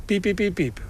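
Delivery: high quick peeps in a row; Piep, piep, piep, piep chirped like a bird; imitating pipit song. Shown as a demonstration. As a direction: peep like a motor starting up. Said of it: "En dan pauze".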